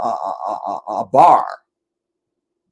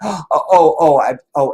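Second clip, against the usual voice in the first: louder, higher breathless oh's of awe; mock swoon; 4 semitones higher, 8 dB louder than usual. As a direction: swoon as if struck by her beauty. Swooning over Janet's beauty.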